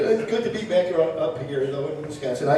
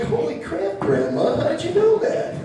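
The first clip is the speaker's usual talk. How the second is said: rising, higher astonished question; shocked grandson; 12 semitones higher than usual. Retold or imitated. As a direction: sound floored by grandma. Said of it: "I'm like".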